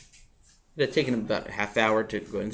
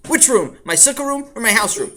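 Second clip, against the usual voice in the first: put-on high, louder smart-aleck kid; cheeky child; 9 semitones higher, 7 dB louder than usual. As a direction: sass the father back. Child talks back cheekily.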